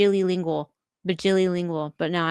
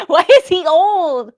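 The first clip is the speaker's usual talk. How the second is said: hammed-up French voice; 7 semitones higher, 8 dB louder, slower than usual. Hammy French mother-child bit.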